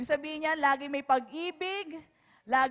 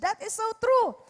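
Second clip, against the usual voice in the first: usual speaking voice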